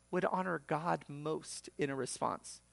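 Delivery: usual speaking voice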